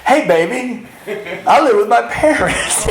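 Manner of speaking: high, hammed-up sleazy come-on; mock pickup line. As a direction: play a clumsy pickup artist.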